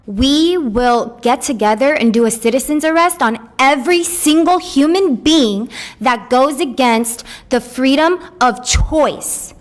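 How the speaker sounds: normal talking voice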